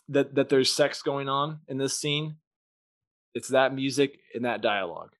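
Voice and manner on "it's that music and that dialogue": ordinary talk, nothing marked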